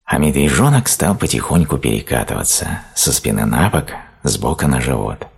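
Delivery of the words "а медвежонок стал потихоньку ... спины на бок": ordinary delivery, nothing marked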